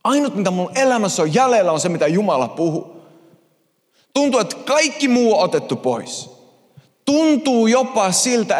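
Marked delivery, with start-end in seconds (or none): none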